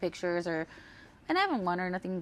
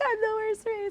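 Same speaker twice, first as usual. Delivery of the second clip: high, whiny mock sob; mock crying whine; 13 semitones higher than usual. Whining to Richard on the way home, mock crying. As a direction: whimper it like a sulking kid. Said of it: "I'm crying to Richard".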